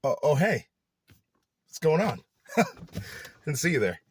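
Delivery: hammed-up YouTuber "oh, didn't see you"; fake surprise. Shown as a demonstration. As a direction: pretend you just noticed the camera. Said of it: "It's fake".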